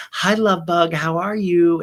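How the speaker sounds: high, loud, sing-song fake-sweet hello; sugary greeting. [name] gushing a fake-sweet hello at Erin.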